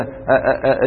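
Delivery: ordinary delivery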